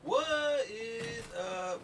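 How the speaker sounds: slow, high, stretched-out hello; drawn-out greeting. Acted. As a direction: draw the greeting out.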